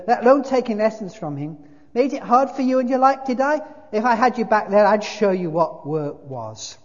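loud, high tirade; angry big ghost, scornful. A narrator's reading. Ghost dismissing the murderer, loud indignant tirade. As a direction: send him packing at full volume.

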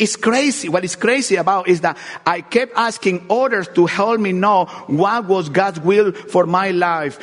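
ordinary delivery

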